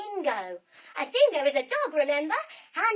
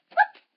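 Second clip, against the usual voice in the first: high, loud cartoon whoop; sudden squeaky jump noise; short high whoop of a hop; hopping whoop; 12 dB louder than usual. Acts out bunny hops.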